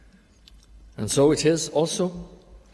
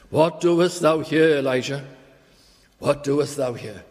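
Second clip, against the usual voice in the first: the Lord's rebuke; 3 semitones higher, 6 dB louder than usual. Acted. The Lord rebukes Elijah.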